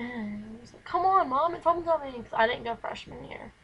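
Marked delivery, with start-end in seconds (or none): 0.8-2.2 higher, louder whiny plea; pleading with mom. Retold or imitated. Teen begging mom to go to homecoming, pleading. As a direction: beg mom to say yes, up high and loud.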